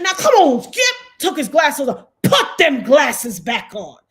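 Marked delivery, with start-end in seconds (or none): 0.0-1.0 high, scolding call-out; mock Shannon checking Skip, pitched higher; imitating Shannon. Shannon scolding Skip on air, high scolding voice. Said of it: "and Shannon said". 2.2-4.0 slow, louder command; imitating Shannon. Shannon ordering Skip to put glasses on, slow command. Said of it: "and Shannon said".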